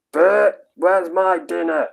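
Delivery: high, slow, drawn-out character call; put-on character voice. Streamer quoting Trapdoor to Rob, high put-on accent. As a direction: call it out high in a cartoon-quote voice. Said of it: "But not in that accent".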